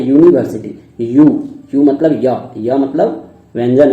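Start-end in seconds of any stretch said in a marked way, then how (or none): 0.0-0.7 यूनिवर्सिटी opening with a य glide; y sound. Shown as a demonstration. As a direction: say यूनिवर्सिटी starting on य. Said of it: "उसको पढ़ते क्या है". (यूनिवर्सिटी य की ध्वनि से शुरू)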